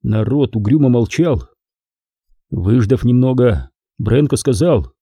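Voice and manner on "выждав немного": plain reading voice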